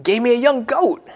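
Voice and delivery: put-on bitter older son. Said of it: "But he says".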